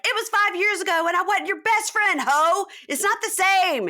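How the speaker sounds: high, loud imitation of a furious comeback; mock angry yell. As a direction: act out her outburst, shouting high. Retold angry outburst, jumps from chair.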